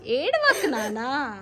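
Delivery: slow, stretched-out hushing; soothing. Real, not acted. Mother pleading with crying child, tender and soothing. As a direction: gently coax someone out of crying, slowly.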